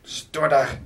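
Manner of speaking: loud, mildly fierce command; acted angry command; snarled order. A narrator's reading. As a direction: snap the order at the captive. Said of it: "freste han".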